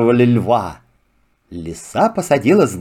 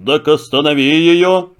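commanding lion. Acted. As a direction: order it like a king of beasts. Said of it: "приказал лев". (властный голос льва)

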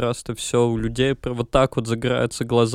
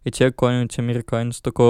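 normal talking voice

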